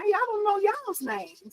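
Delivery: high, soft, slow sheepish confession; embarrassed giggly confession; sheepish laughing. Real, not acted. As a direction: admit it with a giggle.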